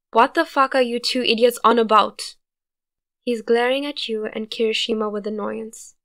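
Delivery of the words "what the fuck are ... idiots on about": loud irritated yell; annoyed shout. A narrator's reading. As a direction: yell at the two idiots.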